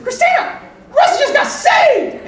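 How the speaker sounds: very high, loud holler across a room; excited yell. Retold or imitated. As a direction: yell the news to someone at the back. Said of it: "Will yelled at".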